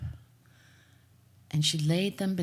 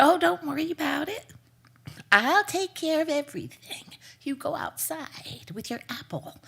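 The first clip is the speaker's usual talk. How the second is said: sweet false reassurance; 9 semitones higher than usual. Acted. Mother's false comfort.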